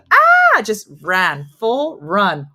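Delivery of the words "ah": loud, very high startled scream; imitating grandma's shriek. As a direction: shriek as if a sudden noise hurt your ears.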